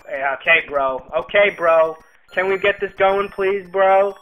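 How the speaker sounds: loud, impatient pleading with the game; annoyed loud pleading. Real, not acted. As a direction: push the game along, fed up. Loud complaint at the slow game.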